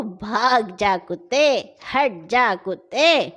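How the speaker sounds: slow, firm shooing of dogs. Acted. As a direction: drive the dog off slowly and firmly. Old man shows the words.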